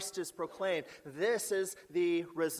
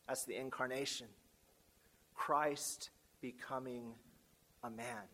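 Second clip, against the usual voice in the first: much softer, dropping almost to a hush; hushed reverence; 4 semitones lower, 9 dB quieter than usual. Real, not acted. Preacher to his congregation, marvelling at God made man, hushed and much softer. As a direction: let the words sink low and soft in awe.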